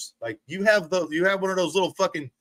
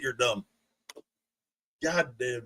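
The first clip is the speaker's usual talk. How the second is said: ordinary talk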